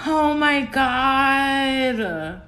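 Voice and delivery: very slow, drawn-out awe; slow stunned. Real, not acted. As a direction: stretch it out in disbelief. Overwhelmed by card.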